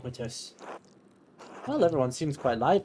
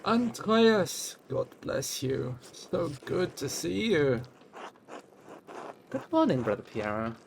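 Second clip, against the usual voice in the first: put-on a failed try at a Cornish accent, pitched higher; half-hearted Cornish accent; 3 semitones higher than usual. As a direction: reach for a Cornish lilt and lose it. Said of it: "I can't do a Cornish accent".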